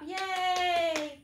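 cheer. Acted. Teacher cheering children's success.

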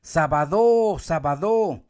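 "Sábado" called as saba-DO, wrong and emphatic; stress on last syllable. Shown as a demonstration. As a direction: shout Sábado leaning hard on do. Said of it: "gritar en los tres lugares".

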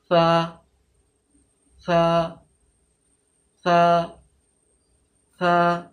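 সা with the tongue tip out, lightly touching the upper teeth; correct tha. Shown as a demonstration. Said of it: "হালকা করে touch করবে".

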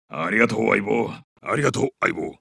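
deep, settled gratitude; low thanks. Acted. Soldier thanks his buddy, low sincere voice.

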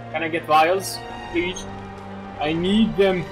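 normal talking voice

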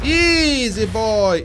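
high, loud plea to back off; loud, urgent soothing; alarmed calming call. Real, not acted. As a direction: shout it to hold off a lunging animal. Calling out to calm lunging beast.

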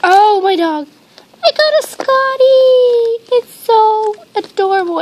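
loud, high squeal, stretched out; real gushing delight at full volume; overwhelmed excitement. Real, not acted. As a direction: squeal with joy at the new find. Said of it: "dying right now because they are so adorable".

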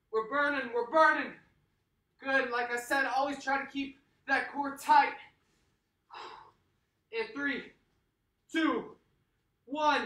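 high, loud pumped-up coaching; hype coach. Acted. Workout coach firing up the class, high and loud. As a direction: fire up the class like a trainer.

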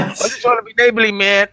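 high, loud and fast, a hammed-up hurt good guy; mock neighborly helper. Friend joins the skit as the helper, mock high whine. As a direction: whine it like a put-upon neighbour.

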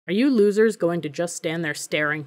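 ordinary delivery

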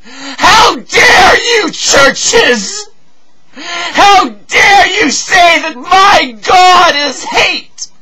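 very high, loud, slow crying shout; anguished wailing fury at full voice; tearful outrage. Acted. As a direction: scream the rebuke through sobs. Rages tearfully at churches.

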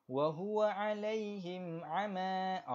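Quran recitation. Shown as a demonstration. Teacher reciting verse for the class, slow melodic tajwid. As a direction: recite for students to follow.

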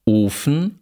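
"Ofen" with a long close o; long tense vowel. Shown as a demonstration. Lecturer reads minimal pairs, long tense vowel. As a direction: hold the o in "Ofen".

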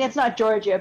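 ordinary talk

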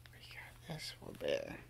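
hushed whisper so the dog won't hear. Real, not acted. Owner whispering about the dog's surprise gift, hushed. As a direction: breathe it almost soundlessly.

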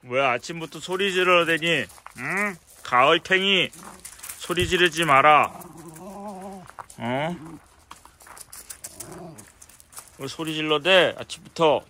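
loud scolding. Real, not acted. Owner telling a yelling pet to stop shouting in the morning, loud scolding. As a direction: scold them loudly for making noise.

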